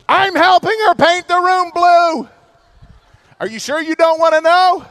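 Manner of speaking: half-shouting at his wife for the answer; loud comic pleading. Retold or imitated. Pastor to his wife, is she sure, loud comic pleading.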